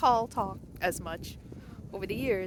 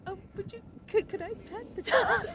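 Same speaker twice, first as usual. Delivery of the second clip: shy eager ask; 12 semitones higher than usual. Retold or imitated. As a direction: ask sheepishly but hopeful. Shyly asking owner to pet dog.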